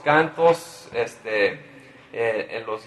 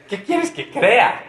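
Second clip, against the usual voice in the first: high, slow, incredulous father; mock-bewildered question, pitched up; doubting Jairo; 9 semitones higher, 3 dB louder, slower than usual. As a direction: ask it as a baffled, grieving dad. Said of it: "Y Jairo dice".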